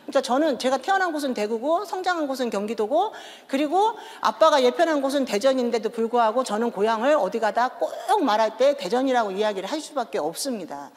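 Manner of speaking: ordinary talk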